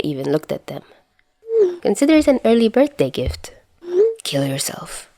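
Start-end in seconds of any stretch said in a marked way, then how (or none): 1.8-3.5 higher, teasing lilt; playful higher voice of the teasing friend; smug tease. Acted. Teasing text from Dazai. 4.2-5.1 flat whispered retort; whispered annoyed comeback; whispered deadpan. Acted.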